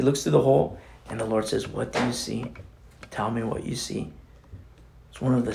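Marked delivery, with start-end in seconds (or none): 1.7-4.1 hushed question. Retold or imitated. The Lord asks Ezekiel.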